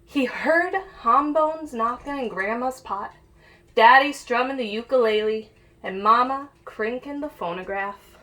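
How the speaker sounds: put-on lively read-aloud for kids; picture book reading. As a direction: read it like storytime for little ones. Reads picture book to children.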